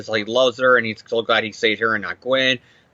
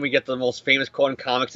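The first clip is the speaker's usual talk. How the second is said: ordinary talk, nothing marked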